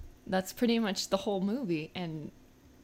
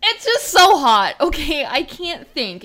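loud whine, starting high and sinking; overwrought whining; 6 semitones higher, 11 dB louder, slower than usual. Acted. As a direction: moan dramatically as if the heat is too much. Complains again about the heat.

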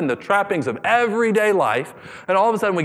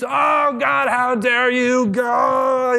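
wailing Jonah; 7 semitones higher, much slower than usual. Retold or imitated. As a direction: ham up a sulking prophet's lament.

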